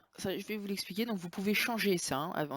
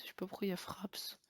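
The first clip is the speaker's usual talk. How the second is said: muttered under the breath to himself; whisper; 11 dB quieter than usual. Real, not acted. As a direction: whisper it, puzzled, almost unvoiced. Marmonne seul devant l'écran. (chuchoté pour soi, perplexe)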